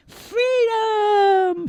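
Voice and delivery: very high, squeaky kitten cry; tiny cat yelling freedom. Acted. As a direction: shout it like a kitten bursting free. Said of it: "said Fluffy".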